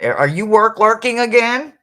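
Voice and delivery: loud, high teasing question; playful call-out. Real, not acted. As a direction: call out the lurker, pitch shooting up. Playful call-out to someone lurking.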